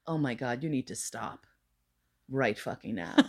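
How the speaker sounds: deadpan warning. Retold or imitated. Annoyed at a guy critiquing her bookshelf, a low, soft warning. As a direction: quietly tell him to stop, low and flat. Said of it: "And I'm like".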